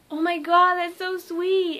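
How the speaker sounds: high, loud, drawn-out gushing. Retold or imitated. Idols seeing fan's tattoo, high gushing delight. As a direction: imitate touched delight, stretching it out.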